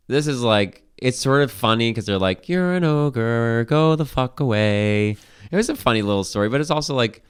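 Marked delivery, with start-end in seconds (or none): 2.5-5.2 slow, raised mocking jeer; mock villagers. Villagers telling the ogre to leave, slow mock jeer. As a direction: drag it out like a sneering crowd. Voicing villagers rejecting Shrek.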